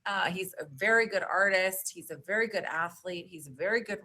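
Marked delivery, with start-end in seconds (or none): none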